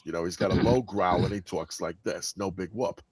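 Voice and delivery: low, growly New Yorker; New York accent. Shown as a demonstration. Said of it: "uh, is from New York".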